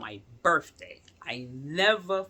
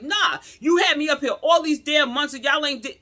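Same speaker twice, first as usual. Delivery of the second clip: loud, high, angry cut-off rant; furious high shout; retold furious yell; 11 semitones higher, 8 dB louder than usual. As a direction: yell at the doctor in disbelief. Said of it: "I was pissed".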